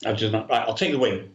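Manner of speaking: ordinary delivery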